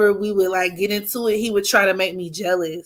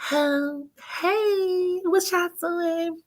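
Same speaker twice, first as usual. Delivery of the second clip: put-on high, breathy sweet-girl voice, much higher; fake girly voice; 9 semitones higher, 6 dB quieter, much slower than usual.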